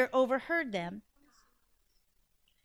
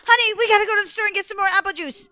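hurried fright; 7 semitones higher, 7 dB louder, much faster than usual. Acted. Frightened mom hurrying out.

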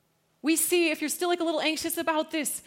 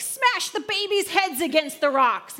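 louder, higher mock-furious cry; vengeful outburst; 5 semitones higher, 7 dB louder than usual. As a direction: burst out like a wrathful psalmist. Acting out David's violent psalm.

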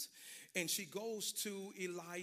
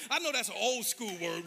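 ordinary talk, nothing marked

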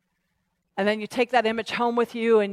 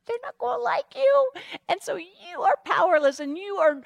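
high-pitched mocking voice; hammed-up tempter taunt; taunting enemy; 8 semitones higher than usual. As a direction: jeer in a squeaky put-on voice.

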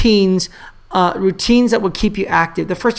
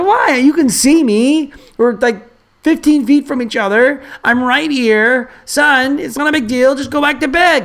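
exasperated dad; 6 semitones higher than usual. Retold or imitated. Dad to his scared son fifteen feet away, mock exasperation. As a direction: reason impatiently with a child, hammed up.

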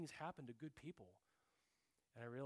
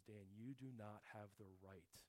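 gentle; 3 semitones lower, 9 dB quieter than usual. Retold or imitated. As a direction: speak it very gently, like a loving father correcting. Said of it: "very, very gently say to me".

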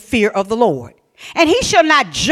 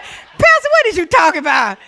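much higher, incredulous "Pastor"; mock incredulous congregant; 6 semitones higher, 5 dB louder than usual. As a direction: squeal it like a stunned churchgoer. Congregation's imagined reaction.

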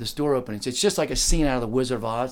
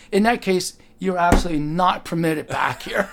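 higher, louder official brush-off, laughter breaking in; doorwoman refusing; 5 semitones higher, 5 dB louder than usual. Retold or imitated. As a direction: deliver the final no with mock officialdom. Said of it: "She goes".

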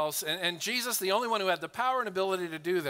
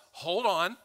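ordinary delivery

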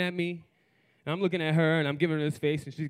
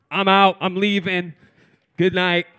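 loud, higher, emphatic declarations; abrupt exit; 4 semitones higher, 10 dB louder than usual. Acted. One partner walking out on the other, loud abrupt declarations. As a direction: announce each short phrase firmly and loudly.

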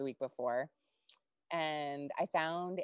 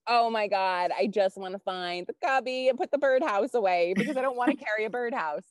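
loud, higher-pitched flustered complaint; exasperated; 6 semitones higher, 6 dB louder than usual. Retold or imitated. To gym people while carrying the birdhouse, exasperated. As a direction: blurt it out, fed up and flustered.

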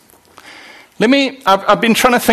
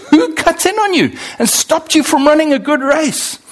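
amused quoting of Paul; 8 semitones higher than usual. Retold or imitated. Paul's question voiced with laugh.

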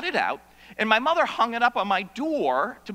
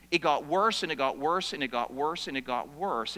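each repeat lower and softer; building repetition; 7 semitones lower, 4 dB quieter than usual. Acted. Preacher tells how agoraphobia grew, fading lower each time. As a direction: let every repeat sink quieter and lower.

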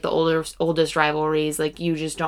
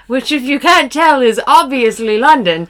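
high, loud fake London accent; put-on British accent; 6 semitones higher, 7 dB louder than usual. Co-host revealing the city is London, put-on British accent. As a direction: lay the mock British accent on thick.

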